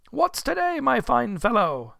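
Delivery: high, loud, hearty question; calling down. Acted. Scrooge at window to boy below, loud hearty call. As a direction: hail a passer-by eagerly. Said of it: "calling downward to a boy".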